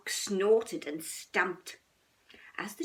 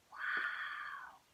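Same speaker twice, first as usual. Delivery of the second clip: awed whisper; 7 dB quieter than usual. A narrator's reading. Awe at the mountain.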